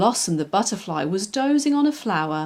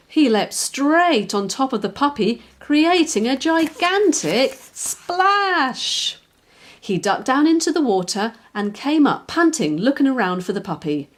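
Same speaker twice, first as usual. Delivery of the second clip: ordinary delivery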